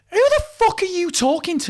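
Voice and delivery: high, loud mock outrage, drawn out; imagine finally telling him off, pitch shooting up; indignant comeback. Imagined angry comeback to the crew member.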